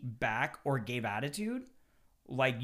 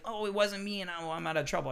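mock weasel; 6 semitones higher than usual. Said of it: "the little weasels".

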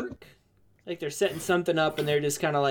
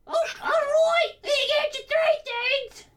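tavern lady voice. Acted.

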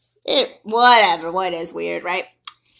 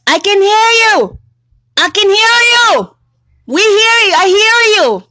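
mock shouted reply, higher and louder; imitating her shouting; 9 semitones higher, 7 dB louder than usual. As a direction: yell it back like the annoyed lady. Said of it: "She goes".

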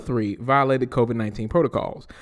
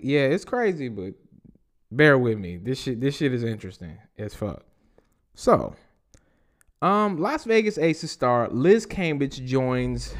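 ordinary delivery

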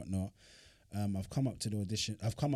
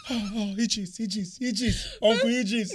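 high, mock fangirl gushing; high, dreamy mock fan voice; starstruck gushing; 11 semitones higher, 6 dB louder than usual. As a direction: gush his name like a starstruck fan. Mimicking starstruck Yemi.

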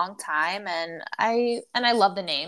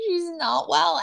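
laughing; 7 semitones higher, 3 dB louder than usual. Real, not acted. Laughing about Brittany's posts.

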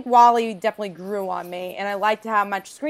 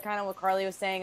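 ordinary delivery

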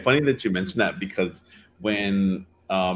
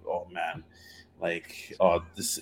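softer, lower, as if thinking aloud; inner thought, dejected; 4 semitones lower, 6 dB quieter, slower than usual. Retold or imitated. Man fearing the trip ends the dating, quiet dejection. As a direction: let it sink, quietly, to yourself. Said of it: "thinking in my head".

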